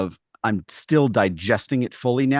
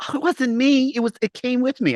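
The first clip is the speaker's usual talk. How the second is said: high, airy fake-innocent voice; mock-innocent gym-goer denying the smell; mock innocent excuse; 13 semitones higher than usual. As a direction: play the innocent culprit, pitched up. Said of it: "call 'em out and be like".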